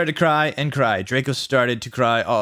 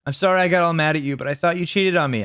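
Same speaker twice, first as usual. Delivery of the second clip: fast, higher gush; rushed apology; 5 semitones higher, faster than usual. Acted. Apologising to Draco.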